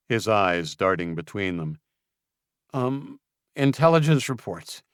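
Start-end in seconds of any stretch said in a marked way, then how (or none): 2.7-4.8 stammered. Acted. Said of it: "he stammered".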